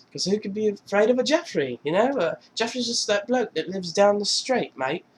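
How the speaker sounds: breezy, singsong British impression; Russell Brand. British rocker explaining the name to panicked friend, mock British accent.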